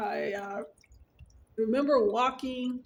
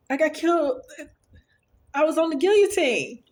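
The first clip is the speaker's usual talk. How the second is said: high, eager, a bit louder; excited retelling; 7 semitones higher, 5 dB louder than usual. Retold or imitated. Wife telling her husband the dream, high and thrilled. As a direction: gush it out like big news. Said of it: "I was so excited".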